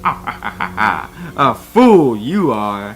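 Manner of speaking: very slow, drawn-out Yoda line; slow Yoda taunt. Acted. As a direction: savour the insult as Yoda. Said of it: "fake Yoda voice".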